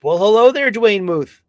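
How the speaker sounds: high, loud hello; excited greeting. Real, not acted. Host greeting a viewer in chat, excited. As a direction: greet a friend warmly and big.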